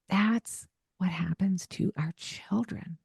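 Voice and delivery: slow whispered aside, almost unvoiced. Real, not acted. Host whispering the lesson to parent listeners, slow and hushed. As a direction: drop to a slow whisper to land the point. Host drives home her point.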